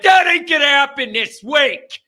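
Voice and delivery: loud, high, emphatic outburst; fired-up refusal. Real, not acted. Host ruling out a tailgate this week, fired up. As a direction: shoot the idea down loudly.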